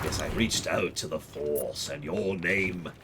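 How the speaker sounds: slow, solemn mock prophecy voice; hammed-up dark lord pronouncement; mock Sith lord. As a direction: drag out the naming like a dark oracle. Mock Sith naming ceremony.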